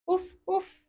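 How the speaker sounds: a high, playful mock bark; dog bark. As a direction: bark like a friendly puppy for the kids. Goes the dog.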